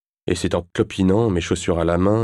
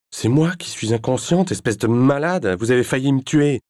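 higher, louder furious outburst; 7 semitones higher, 3 dB louder than usual. Acted. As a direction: lash out at him. Furious after near fall. (elle explose de fureur)

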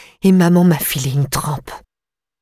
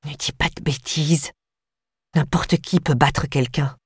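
low growl; 5 dB quieter than usual. A narrator's reading. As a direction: grumble it back at her sister. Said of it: "Chana gronda en retour".

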